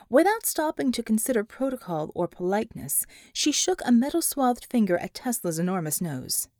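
plain reading voice

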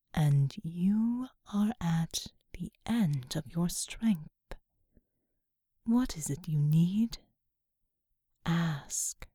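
low breathy whisper of a vast angel; whispering angelic being. Acted. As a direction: whisper low and otherworldly, slowly. Seraphim speaks.

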